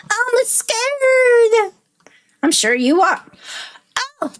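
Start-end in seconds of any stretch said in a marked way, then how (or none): 0.1-1.7 higher, wailing "I'm scared" with a long fall; little kid wailing "scared" the second time; scared child, more insistent. Acted. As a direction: push the repeat further into fear. Child repeats fear, building.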